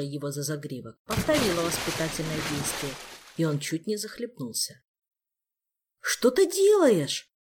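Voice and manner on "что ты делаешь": high, loud frightened cry; испуганный вскрик. A narrator's reading. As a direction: cry out in alarm, pitch leaping up. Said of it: "испуганный вскрик".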